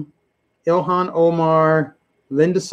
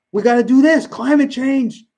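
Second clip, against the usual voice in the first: higher, lightly mimicked alarmist; mock political alarm; 7 semitones higher than usual. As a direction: imitate an urgent rallying cry, drawing it out.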